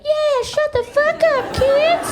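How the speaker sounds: very high, slow cartoon squeak; talking cigarette. Cigarette backing up mom, squeaky sidekick voice. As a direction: echo mom as her tiny cigarette sidekick. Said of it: "the cigarette would be like".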